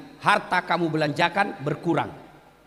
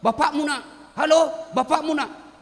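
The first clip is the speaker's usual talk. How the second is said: mock yell; 9 semitones higher than usual. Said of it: "dia teriak".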